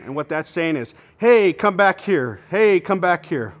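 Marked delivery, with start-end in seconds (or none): 1.2-3.5 calling out. Pretend. Voicing duck comeback call.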